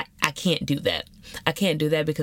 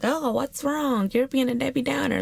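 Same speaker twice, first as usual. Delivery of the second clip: mock concern; 10 semitones higher than usual. Mimics people questioning her quiet mood.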